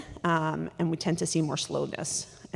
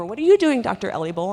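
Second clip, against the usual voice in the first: imitating a nosy kid, high and loud at first; overfamiliar young patient; 4 semitones higher, 8 dB louder than usual. Young patient coming over to the doctor at the computer, overly familiar. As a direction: pipe up loudly, butting in on the doctor. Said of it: "they're coming over".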